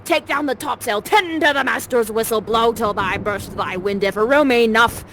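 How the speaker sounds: loud orders yelled across the deck; shouting sailor. Acted. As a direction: roar the commands over the wind. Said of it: "Pretend like you know what you're doing".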